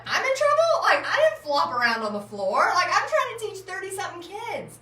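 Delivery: high, loud indignant disbelief; appalled protest. Retold or imitated. Teacher blamed by the principal, appalled and indignant. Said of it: "I mean, I was just appalled".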